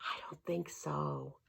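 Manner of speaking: slow, breathy whispered aside. Real, not acted. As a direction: whisper it to yourself, doubtful. Whispered aside after book line.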